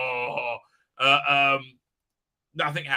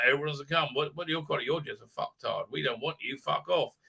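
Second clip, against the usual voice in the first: ordinary talk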